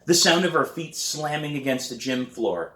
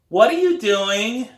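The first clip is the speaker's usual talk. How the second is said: put-on high, slow kid's nagging question; little brother voice; 12 semitones higher, 8 dB louder, slower than usual. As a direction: play the pestering little brother, pitched way up. Brian repeats his question.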